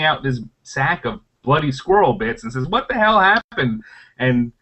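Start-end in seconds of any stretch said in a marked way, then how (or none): 2.7-3.8 high-pitched mock astonishment; shocked question. Party returning to bloody squirrel bits, shocked. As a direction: act out the aghast returning party.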